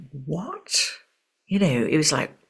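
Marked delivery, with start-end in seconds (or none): 0.1-0.7 hushed, stunned question; retold stunned disbelief. Friend retells hearing of her student's death, stunned. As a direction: ask it very softly, as if stunned.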